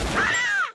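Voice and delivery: very high loud shriek; sharp scream. Acted. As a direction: shriek in shock. Sudden scream on phone.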